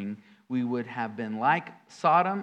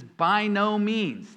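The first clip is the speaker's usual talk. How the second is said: slow, high, loud By no means, each word weighted; emphatic denial; 5 semitones higher, 4 dB louder, much slower than usual. Retold or imitated. Preacher to congregation, quoting Paul's emphatic denial. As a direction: stress every word of By no means, slowly. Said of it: "It's emphatic".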